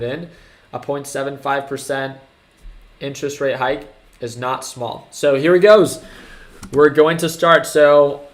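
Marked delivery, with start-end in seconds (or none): 5.1-8.2 excited. Real, not acted. The Fed announcement is starting.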